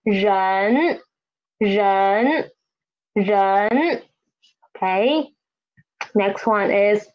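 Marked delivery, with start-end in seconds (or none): 0.1-4.0 ren dipped low, then rising; second tone. Shown as a demonstration. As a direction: model ren with a clear upward glide. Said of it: "The tone is second tone".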